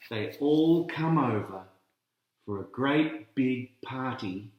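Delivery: ordinary delivery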